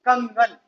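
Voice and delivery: कंगन with a nasal 'ng' before the ग; अनुस्वार सहित उच्चारण. Shown as a demonstration. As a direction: sound the anusvara through the nose in कंगन. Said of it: "इसके ऊपर ये बिंदु लगा दिया". (कंगन, नाक से 'ङ्' की ध्वनि के साथ)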